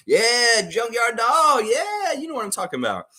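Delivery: high, loud wrestling-fan hype; big excited shoutout; hyped shout. Acted. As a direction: bellow the name like a ring announcer.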